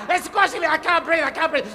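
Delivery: high, laughing cries that he can't breathe; mock suffocating panic. The dreaming soldier in hammed-up panic, gasping. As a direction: play the squashed sleeper for laughs. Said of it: "he hears this guy say".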